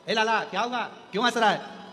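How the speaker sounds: lightly voiced parent, calling out a question; puzzled parent. Parent asking a laughing child why, puzzled calling.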